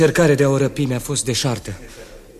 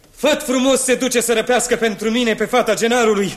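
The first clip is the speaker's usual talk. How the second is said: poruncă veselă; 10 semitones higher, 3 dB louder than usual. Acted.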